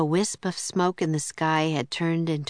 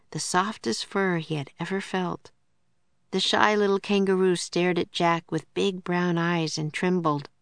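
ordinary delivery, nothing marked

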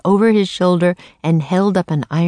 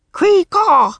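high, loud raven squawk; bird croak; 14 semitones higher, 5 dB louder than usual. A narrator's reading. Raven King orders his bodyguard, high loud croak. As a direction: screech it like a raven. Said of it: "he croaked".

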